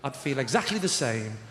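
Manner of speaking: deadpan. Retold or imitated. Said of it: "I said".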